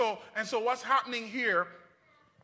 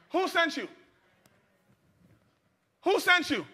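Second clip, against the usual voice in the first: demanding crowd member; 5 semitones higher than usual. Pretend. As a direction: press Moses harder each time. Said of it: "if I'm in the crowd".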